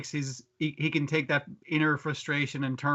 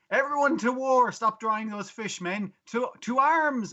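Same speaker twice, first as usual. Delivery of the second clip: rallying war cry; 6 semitones higher, slower than usual. Retold or imitated. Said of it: "He's like".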